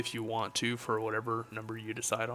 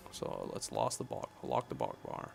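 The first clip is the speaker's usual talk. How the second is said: low, soft mumble to yourself; half-voiced working mumble; muttering to self; 13 semitones lower, 6 dB quieter than usual. Real, not acted. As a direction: think aloud quietly while busy with a task. Muttering to self while fixing layout.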